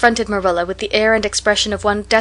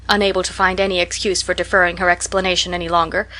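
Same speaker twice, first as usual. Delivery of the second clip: ordinary delivery, nothing marked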